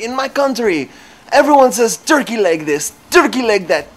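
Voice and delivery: high, loud, hammed-up Russian rapture; mock Russian accent. Joker explaining the customer's excitement out loud, mock Russian accent. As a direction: rave about food in a put-on Russian accent. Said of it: "saying things in that accent".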